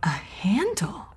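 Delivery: slow hushed whisper; secretive whisper. Acted. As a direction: whisper the find in wonder. Discovering a hidden trap door.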